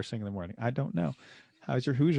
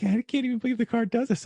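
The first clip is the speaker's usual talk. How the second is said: amazed passenger; 11 semitones higher than usual. Retold or imitated. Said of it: "she was saying".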